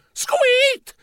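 very high startled mouse squeak; high-pitched cartoon squeak. A narrator's reading. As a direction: squeak like a mouse yanked off its feet. Mouse yanked inside squeaks.

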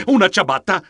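plain reading voice

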